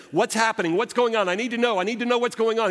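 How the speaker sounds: high, loud anxious pleading; urgent Martha. Retold or imitated. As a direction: voice a frantic Martha demanding answers. Martha voiced, urgent demand.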